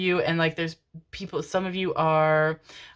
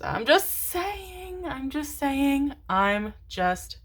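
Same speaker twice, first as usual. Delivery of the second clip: very slow, starting high and sliding down; slow drawn-out high tease; slow sing-song; 8 semitones higher, much slower than usual. Pretend. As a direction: drag each word out teasingly. Drawn-out teasing repeat.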